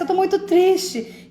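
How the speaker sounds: high, slow, overplayed "I'm so sad"; milk the sadness for attention; dramatic sadness. Mimicking someone dramatizing to everyone, mock-dramatic. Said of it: "E o drama é quando você identifica a tristeza, mas você não sente".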